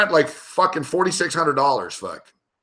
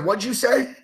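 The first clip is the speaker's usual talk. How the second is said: higher, restrained retold challenge; confrontational demand; 8 semitones higher, 4 dB quieter than usual. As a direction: repeat the question as a pointed dare. Repeats retold confrontational question.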